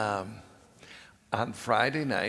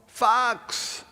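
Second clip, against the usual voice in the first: high, loud campfire shout; yelled out; 13 semitones higher, 6 dB louder than usual. Retold or imitated. Staff member yelling out a camp nickname, loud high shout. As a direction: yell it out like a name call. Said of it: "somebody would yell out".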